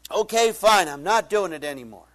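loud, exasperated giving in, starting high; retold argument. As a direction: act out a huffy, shouted surrender. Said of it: "This big argument is going on".

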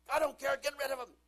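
loud, high mock shout of a furious boss; angry boss yell. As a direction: bellow it like a raging boss. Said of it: "boss voiced in analogy".

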